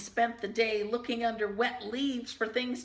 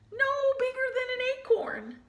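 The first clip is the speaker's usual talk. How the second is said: tiny voice; 14 semitones higher, much faster than usual. A narrator's reading. Tiny mouse, squeaky aside.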